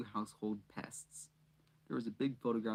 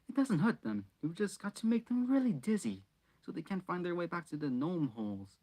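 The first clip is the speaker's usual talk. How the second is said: higher, eager explanation; eager Ron; 6 semitones higher than usual. A narrator's reading. Ron reassuring shocked Harry, eager and high.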